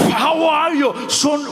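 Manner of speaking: put-on high, booming-happy Austrian-accented greeting; Arnold Schwarzenegger. Star grabbing a fan's hand, hearty impression. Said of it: "and he grabbed it".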